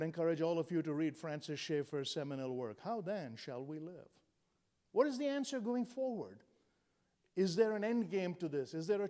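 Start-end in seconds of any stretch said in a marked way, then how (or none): none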